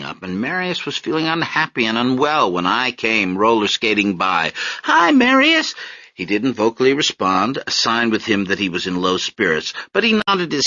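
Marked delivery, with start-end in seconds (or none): 4.8-5.7 put-on little girl. Little girl greeting on skates.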